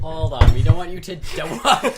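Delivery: loud, half laughing. Pretend. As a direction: project it loudly through a giggle. Answers the call to talk louder.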